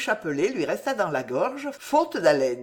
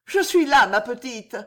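Madeleine comforting; 5 semitones higher, 6 dB louder than usual. Acted.

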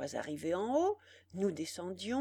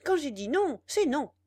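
higher, louder furious voice; 7 semitones higher, 7 dB louder than usual. A narrator's reading. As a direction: lay down the law in fury. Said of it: "plus furieuse encore".

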